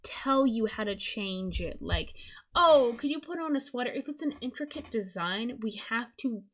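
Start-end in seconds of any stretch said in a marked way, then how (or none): 2.5-3.9 mock request. Voicing a sample client request.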